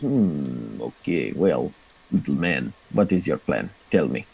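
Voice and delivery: a hint of a bored drow boss; unimpressed drow. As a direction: make him feel small. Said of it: "looks supremely unimpressed".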